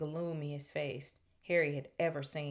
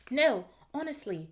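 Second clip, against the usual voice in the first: insistent protest; 8 semitones higher, 6 dB louder than usual. A narrator's reading. Hermione protesting to Myrtle.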